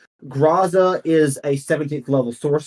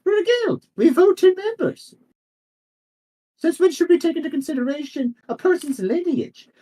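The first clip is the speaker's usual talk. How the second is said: very high-pitched protesting councillor; high, insistent character voice; indignant guild member. Acted. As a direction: argue in a voice pitched right up.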